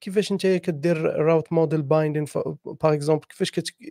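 normal talking voice

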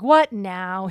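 loud mock-exasperated retort; eye-rolling exasperation. David answering his brother, loudly exasperated in retelling. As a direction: burst out loudly as a fed-up younger brother. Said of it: "rolling his eyes and being like".

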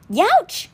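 sudden high yelp of pain; youch cry. A narrator's reading. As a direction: yelp as the flame singes his arm. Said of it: "he cried".

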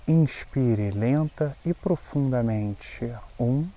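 slow, calm breathing guide; slow breath counting. Acted. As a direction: count the breaths slowly and gently.

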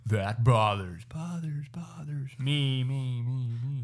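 trailing reverb done by mouth; echo sound effect. Shown as a demonstration. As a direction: repeat it like a dying echo. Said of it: "You have to do sound effects".

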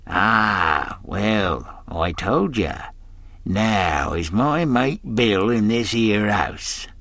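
leering, wheedling pirate voice; a fawning seaman with a hidden threat; sly old sailor. Acted. Stranger voiced in read story.

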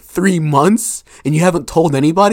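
high-pitched, quickening mock disbelief; acted incredulous question. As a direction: echo it back, imitating someone appalled. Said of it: "And he's like".

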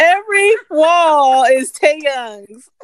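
slow, loud, laughing drawl; laughing at her own joke. Real, not acted. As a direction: stretch it out while cracking up. Laughing over the wall joke.

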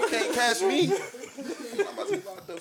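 said through high laughter; laughing it out. Real, not acted. Laughing while quoting friend.

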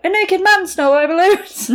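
put-on high-pitched child telling mum; high, mock-childish complaint; tattling little kid. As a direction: report the outrage like a small boy.

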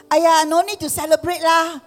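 high, loud Singlish mum protesting; mum's voice in Singlish brushing it off. Retold or imitated. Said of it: "All Chinese people say".

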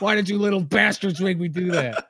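mock outrage over the smashed bottle; upset rant. Older guy scolding the kids for the smashed bottle, mock angry yell. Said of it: "he just looks so upset with us".

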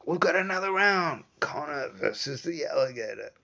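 high, loud ring announcer calling the next bout; fight announcer. Retold or imitated. Imagining the UFC crowd hearing the next bout, loud announcer voice.

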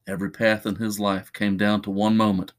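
usual speaking voice

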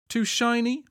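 high teasing question; smirking. A narrator's reading. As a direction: needle a friend with a light smirk. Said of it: "Maelstrom smirked".

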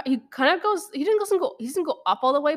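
ordinary talk, nothing marked

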